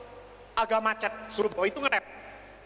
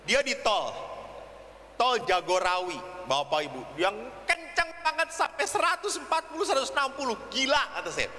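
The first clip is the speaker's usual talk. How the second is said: usual speaking voice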